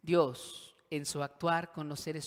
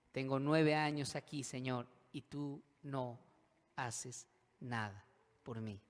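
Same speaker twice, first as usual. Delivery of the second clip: dejected lament; 3 semitones lower, 4 dB quieter than usual. Acted. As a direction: let it sink, word by word, in defeat. José's lament to God.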